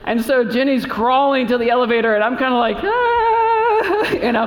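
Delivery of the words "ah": high, held helpless "ah"; mock helpless high whine; helpless squeal. Husband helpless as wife crawls.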